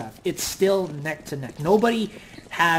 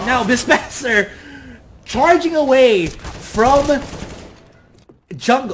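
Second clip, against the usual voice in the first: excited; 6 semitones higher, 8 dB louder than usual. Real, not acted. Live play-by-play rising action.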